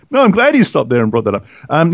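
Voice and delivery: ordinary talk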